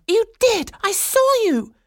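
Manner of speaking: very high, loud outburst; furious accusation. A narrator's reading. Swanhilda cutting off Franz's denial, shouting furiously. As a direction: cut in with a piercing shout. She cuts off his denial.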